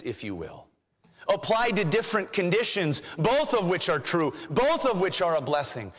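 normal talking voice

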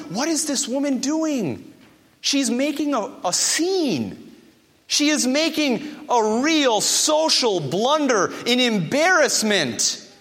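hammed-up social disapproval; mock scandalized. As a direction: play an appalled dinner guest, stretching the key words. Imagined Pharisee scandalized by the woman.

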